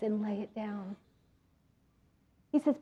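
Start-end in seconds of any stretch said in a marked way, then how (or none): 0.0-1.0 soft loving voice of Jesus; beautiful gentle voice. Retold or imitated. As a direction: lower and much softer, tender. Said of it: "in his beautiful voice".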